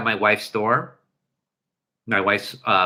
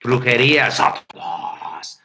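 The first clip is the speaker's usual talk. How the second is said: spooky incantation; 3 semitones lower, slower than usual. Pretend.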